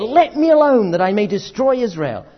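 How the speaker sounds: God telling Moses to stand aside, loud; God's loud demand. Retold or imitated. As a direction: push Moses away with the words. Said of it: "And God said".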